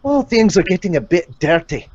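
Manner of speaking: cheeky Scottish late-night host accent; a Craig Ferguson style Scottish accent, teasing. Shown as a demonstration. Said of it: "pulls me into that Scottish accent".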